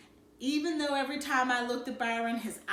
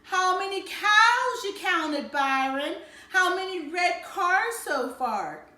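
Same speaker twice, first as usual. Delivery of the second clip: higher, louder, slow mocking sing-song; loud taunt; 10 semitones higher, 6 dB louder, slower than usual. A narrator's reading. Little brother loudly taunts Byron about car games. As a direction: rub it in so everyone hears. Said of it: "I said out loud to Byron".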